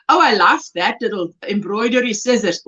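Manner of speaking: ordinary delivery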